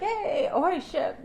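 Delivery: high mock hail to a ship; mock ship call. Calling out to a ship from shore.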